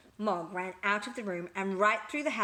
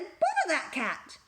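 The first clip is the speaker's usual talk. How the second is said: higher, slow, exasperated grumble; annoyed burglar; 9 semitones higher, 4 dB louder than usual. A narrator's reading. Burglar caught by the meow, slow and exasperated. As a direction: curse the cat, caught out. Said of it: "The burglar said".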